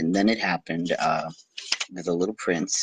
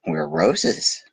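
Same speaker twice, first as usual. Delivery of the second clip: higher, light flower voice; lightly voiced roses; roses answering; 9 semitones higher than usual. A narrator's reading. The roses telling the prince who they are, lightly higher. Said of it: "said the roses".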